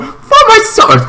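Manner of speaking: very high, exclaimed demand; mock high-pitched outburst; demanding cry. Acting out Bo-Katan's demand.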